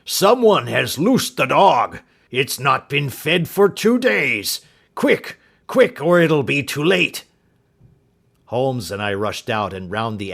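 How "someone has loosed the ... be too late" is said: loud, slow, high frantic warning; old servant in terror; a frightened old man crying out; panicked cry. Acted. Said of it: "he cried".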